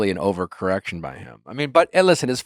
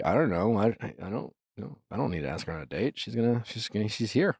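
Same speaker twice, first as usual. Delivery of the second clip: imitating a noncommittal boyfriend, much softer; offhand mumble; 11 dB quieter than usual. Imagined boyfriend not bothering to ask her out, offhand mumble.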